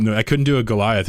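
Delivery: normal talking voice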